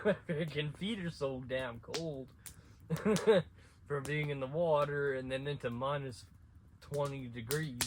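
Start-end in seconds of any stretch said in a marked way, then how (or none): 2.3-3.8 laughs. Real, not acted. Laughing at own story.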